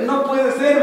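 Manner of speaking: put-on a young man blurting out his amazement; astonished outcry. Young man exclaims disbelief.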